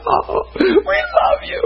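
very high, slow, hammed-up weepy gushing; mock tearful worship. Preacher imitating crying women at worship, mock tearful. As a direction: wail it like an overcome worshipper. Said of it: "and they're crying".

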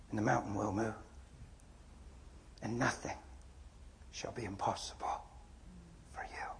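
Jesus speaking softly to Adam; gentle Jesus voice. Retold or imitated. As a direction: speak like Jesus calming a grieving man.

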